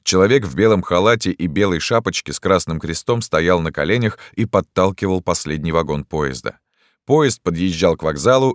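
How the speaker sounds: usual speaking voice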